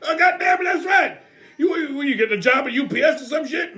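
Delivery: rowdy grandpa yelling insults; cranky Pops. Acted. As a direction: holler a mocking jab. Pops mocks guest's brown outfit.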